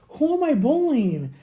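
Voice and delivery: who, me? outrage, drawn out; indignant disbelief. Retold or imitated. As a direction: demand it, baffled and offended. Outraged at TikTok ban.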